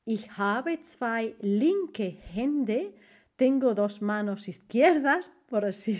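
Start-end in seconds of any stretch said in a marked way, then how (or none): 0.1-3.0 very slow German idiom; teacher pacing out a German idiom; slow model phrase. Shown as a demonstration. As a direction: say it slowly for the class. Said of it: "en alemán se dice".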